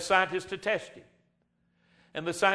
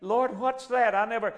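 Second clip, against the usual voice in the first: mock confused Adam; 5 semitones higher than usual. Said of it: "he didn't say".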